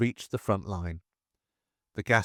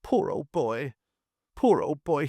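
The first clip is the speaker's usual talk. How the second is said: put-on a higher, tearful wail over the wounded boy; mild higher-pitched blubbering, in tears; 4 semitones higher than usual. A narrator's reading. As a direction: weep the words out, close to breaking.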